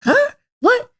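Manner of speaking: high, rising what, what; confused asking. Retold or imitated. Daughter can't read dad's lips, asks in high rising confusion. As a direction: strain to catch what he mouths. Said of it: "I'm like".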